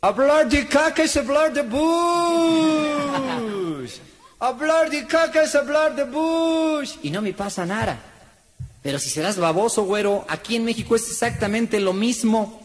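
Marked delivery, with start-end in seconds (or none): 0.0-6.9 high, slow taunting chant; mock taunt. Gringo shouts a taunt to Bush's face, slow and high. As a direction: drag out the insult in his face. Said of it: "en su mera carota le digo".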